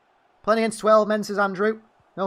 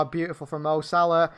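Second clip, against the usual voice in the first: ordinary talk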